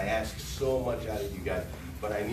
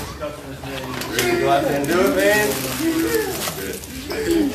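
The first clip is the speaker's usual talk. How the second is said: loud, high greeting across the showroom; calling out; 7 semitones higher, 9 dB louder, slower than usual. Real, not acted. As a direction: call it out big to a returning salesman.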